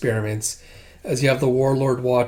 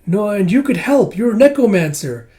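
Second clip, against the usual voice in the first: high, strained little voice, louder; pleading like an anxious small creature; Kippa's voice; 8 semitones higher, 7 dB louder than usual. Retold or imitated. Kippa begging Master Ren to help Miss, high pleading voice. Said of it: "And Kippa's like".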